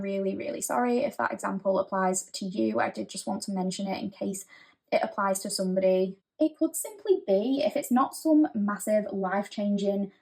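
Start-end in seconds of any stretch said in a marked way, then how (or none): none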